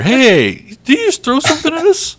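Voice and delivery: high, loud accusing shout; indignant guard. Acted. Game master plays a guard accusing unseen pranksters, indignant shout. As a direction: fire it out fast and high, hammed-up outrage. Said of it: "but you hear".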